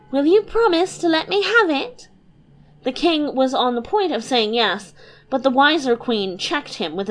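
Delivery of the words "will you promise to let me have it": put-on high, bubbly girlish voice; a light, giddy young princess. Princess teasing her papa and mamma, high and bubbly.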